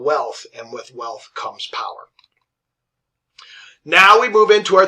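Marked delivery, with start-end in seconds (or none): 0.5-2.1 dramatic hushed whisper. Shown as a demonstration. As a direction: breathe it like a secret. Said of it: "whispered aside".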